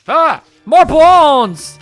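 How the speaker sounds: slow, loud greedy cry; excited shout. Acted. Fortune teller greedily exclaims.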